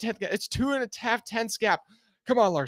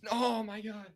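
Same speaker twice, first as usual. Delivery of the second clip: usual speaking voice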